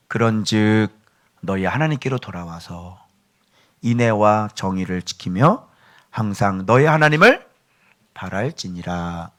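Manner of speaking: 천천히 경건하게 성경 구절을 읽듯; 말씀을 무게 있게 낭독하기; solemn scripture reading. Acted. Reads scripture verse aloud. (설교자가 회중에게 성경 구절을 낭독하며, 엄숙하게)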